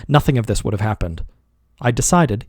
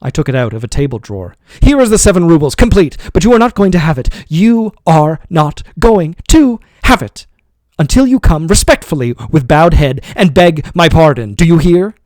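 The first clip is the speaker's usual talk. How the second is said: loud, higher, triumphant anger; furious taunt; 5 semitones higher, 7 dB louder than usual. A narrator's reading. Master taunting servant with withheld wages, loud. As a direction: rub the money in his face.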